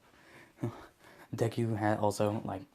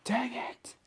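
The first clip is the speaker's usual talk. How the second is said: frustrated outburst. Acted. Deku loses hold of the villains.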